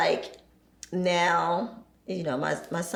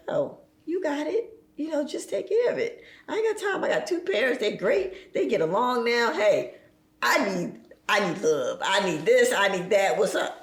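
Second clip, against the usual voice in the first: put-on much higher, breezy kid voice tossing it all off; carefree youngest daughter; 5 semitones higher than usual. Youngest daughter brushing off her siblings, high carefree voice. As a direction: play the baby of the family who can't be bothered. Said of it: "She's sitting there like".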